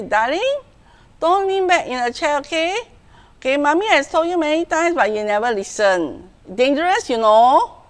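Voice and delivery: non-assertive pleading. Shown as a demonstration. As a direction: gently plead with the child, no firmness. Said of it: "this is one type of response".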